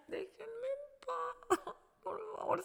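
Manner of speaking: high, soft, drawn-out sobbing words; crying plea. Real, not acted. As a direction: plead through real tears, voice thin with crying. Relives plea to be left alone. (súplica ahogada en llanto)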